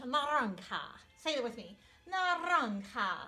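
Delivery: naranja, J said as H. Shown as a demonstration. Teacher having the class repeat the word. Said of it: "The J sound like an H".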